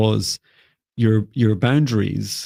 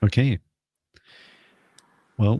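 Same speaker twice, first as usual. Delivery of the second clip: ordinary talk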